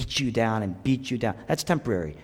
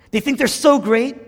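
higher, louder scornful voice of God; mocking the powerful; 11 semitones higher, 7 dB louder than usual. Preacher voicing God scorning the proud. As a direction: sneer at the proud. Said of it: "He says".

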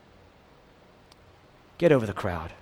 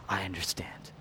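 whispered, lower and much softer; 7 semitones lower, 12 dB quieter than usual. Acted. Pastor voicing Jesus to listeners, whispering. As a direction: breathe it out as a secret.

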